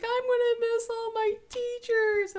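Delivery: high-pitched mock wail; whiny, tearful imitation; crying. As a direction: imitate a child bawling to mom. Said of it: "she's just crying".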